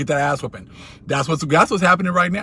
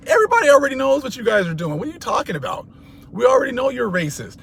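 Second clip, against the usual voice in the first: much higher and fast, rising indignation; exasperated outburst; 9 semitones higher, faster than usual. Real, not acted. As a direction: throw it back at them, fed up. Outburst at the accusers.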